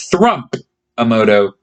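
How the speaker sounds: ordinary delivery, nothing marked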